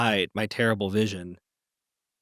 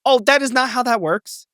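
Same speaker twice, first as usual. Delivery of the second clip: indignant; 12 semitones higher, 7 dB louder than usual. Real, not acted. Disputing pleading the fifth.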